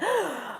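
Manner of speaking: sharp breath snatched in amazement; awed gasp. Retold or imitated. Looking down at the clouds, awed gasp. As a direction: gasp as if your breath is taken away. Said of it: "I just went".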